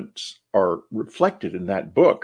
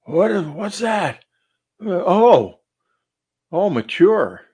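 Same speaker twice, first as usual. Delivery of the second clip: higher, slow startled questioning, as if just woken; startled waking; 8 semitones higher, 4 dB louder, slower than usual. Retold or imitated. Recounting a night recording of waking to a sound, startled. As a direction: imitate yourself waking up startled, pitched up. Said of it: "you hear me wake up".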